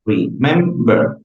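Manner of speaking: "Remember" slowly in three beats, re-mem-ber; split into syllables. Shown as a demonstration. Teacher counting syllables for students, "Remember" slowly split in three.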